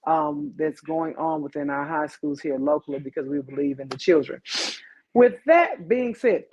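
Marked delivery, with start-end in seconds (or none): 5.1-6.5 announcer voice. Acted. Showy transition.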